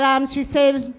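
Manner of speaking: ordinary talk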